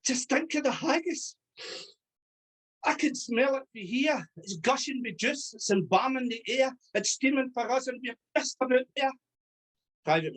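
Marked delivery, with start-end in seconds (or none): 0.0-9.1 shouting encouragement. A narrator's reading. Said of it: "shouts down in his ear".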